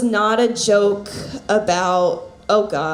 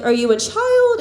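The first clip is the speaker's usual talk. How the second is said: mock incredulous question; 11 semitones higher than usual. Man asks, retold.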